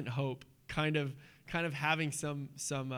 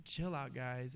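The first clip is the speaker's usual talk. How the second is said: laid-back calm; 5 semitones lower, 8 dB quieter, slower than usual. Retold or imitated. Jesus calming panicked disciples.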